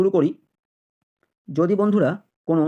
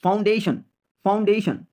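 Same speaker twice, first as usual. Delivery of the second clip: usual speaking voice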